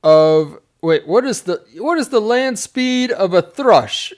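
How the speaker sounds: high, loud, drawn-out mock challenge; bridge questioner. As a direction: intone the question slowly and high like a comic gatekeeper. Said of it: "the next guy says".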